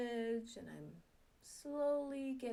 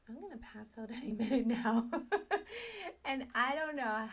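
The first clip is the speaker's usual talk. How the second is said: laughing; 3 semitones higher, 7 dB louder than usual. Real, not acted. Laughing while joking about fainting.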